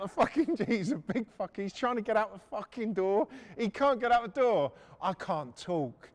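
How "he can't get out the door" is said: ordinary talk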